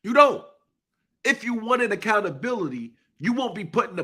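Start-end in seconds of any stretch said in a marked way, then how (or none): none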